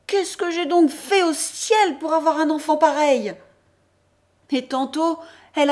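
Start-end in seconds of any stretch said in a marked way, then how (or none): 0.1-3.3 loud, higher lament to the heavens; exclaiming. Acted. Mother rousing the family: loud, high outcry. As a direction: cry out to the family in despair. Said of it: "s'écrie".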